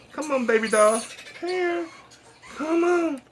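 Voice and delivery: very high coaxing call. Real, not acted. Calling bird to come.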